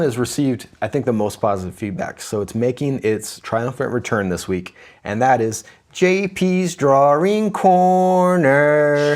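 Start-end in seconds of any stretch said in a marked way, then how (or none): 5.9-9.2 slow, loud drawn-out announcement; announcer. Acted. Host announces the drawing segment, slow announcer voice. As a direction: stretch out the segment name like a host.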